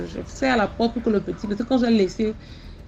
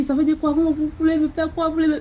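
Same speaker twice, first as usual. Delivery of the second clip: higher, raised and defiant; speaking loudly; 7 semitones higher than usual. Retold or imitated. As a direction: push back at her, voice up. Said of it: "je commence à parler fort comme ça". (à voix haute, sur un ton de défi)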